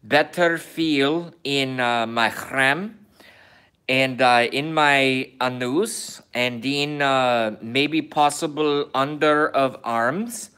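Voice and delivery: a light mock Kazakh Borat accent in broken English. Vlogger joking to viewers in a light mock Borat accent. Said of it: "accent of Borat".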